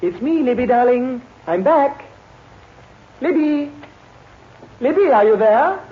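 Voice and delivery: loud, high call through the house. Acted. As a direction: call to your wife in another room. Husband calls to wife.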